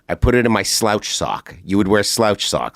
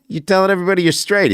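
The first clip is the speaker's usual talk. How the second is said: rattled off fast and high; quick high mock disbelief; mock incredulous; 10 semitones higher, much faster than usual. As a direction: fire it off like a disbelieving buddy. Retold challenge to coworker.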